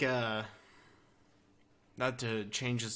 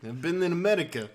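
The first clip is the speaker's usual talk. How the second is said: put-on comic Russian accent, raised; 6 semitones higher, 7 dB louder, faster than usual. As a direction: say it in mock Russian. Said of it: "or Russia".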